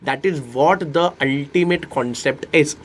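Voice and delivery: very slow, each word spaced out; teacher stretching the key line; slow and deliberate. Shown as a demonstration. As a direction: lay out the key idea slowly. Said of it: "teacher slows for key point".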